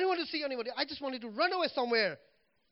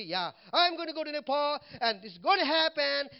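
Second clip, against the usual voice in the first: fake confidence; 5 semitones higher, 5 dB louder than usual. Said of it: "I can just fake it".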